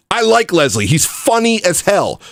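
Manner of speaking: ordinary delivery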